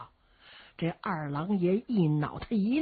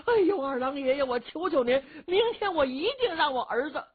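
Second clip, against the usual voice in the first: pleading; 7 semitones higher than usual. Acted. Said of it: "老太太这一求啊".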